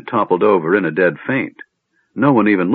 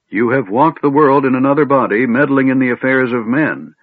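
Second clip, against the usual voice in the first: raised, loud reprimand; stern accusation; 6 semitones higher, 4 dB louder than usual. A narrator's reading. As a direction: lay out the charges against her with authority. Old mage accuses Silvara.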